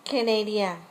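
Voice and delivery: normal talking voice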